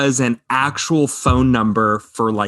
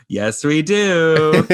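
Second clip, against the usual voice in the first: drawn-out, higher singsong reply; calling from afar; 5 semitones higher, much slower than usual. Retold or imitated. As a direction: imitate someone calling slowly from across the restaurant. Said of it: "from the distance you hear say".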